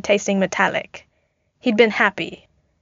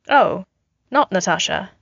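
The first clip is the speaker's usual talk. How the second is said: ordinary delivery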